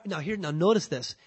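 normal talking voice

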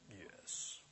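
a bare whispered yes. Acted. God answering the believer one last time, in a whisper. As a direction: breathe the answer, almost nothing. God's quiet final answer.

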